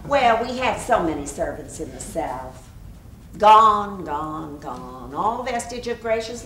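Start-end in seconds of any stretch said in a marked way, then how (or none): 3.4-5.1 fading lament. Acted. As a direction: let the word die away. Lament, each gone softer.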